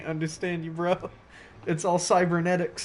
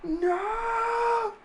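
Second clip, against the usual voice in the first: wailing cry; much slower than usual. Retold or imitated. Reenacting the dramatic cry.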